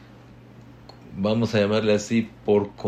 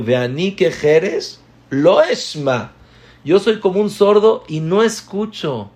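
fervent preaching; 8 semitones higher, 7 dB louder than usual. Acted. The rabbi quoting the psalm to his students with fervour. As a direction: proclaim the verse, then its meaning.

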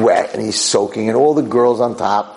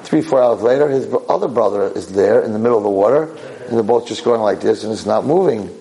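ordinary talk, nothing marked

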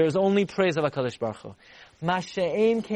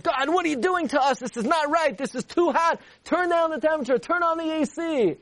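mock complaining scream; 11 semitones higher than usual. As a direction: wail it out high like the burning souls. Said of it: "Would we expect the Rishon to scream out".

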